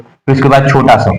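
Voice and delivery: ordinary talk, nothing marked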